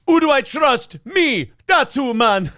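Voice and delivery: high-pitched Jamaican patter; Jamaican accent. Acted. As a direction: put on a comic Jamaican accent. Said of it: "Him's going to Jamaica".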